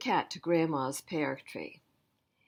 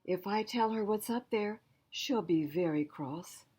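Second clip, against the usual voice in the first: usual speaking voice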